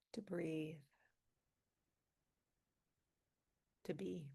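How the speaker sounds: calm meditation guide with long pauses; guided meditation. Acted. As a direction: ease them gently into stillness, leaving space between phrases. Leads a centering meditation.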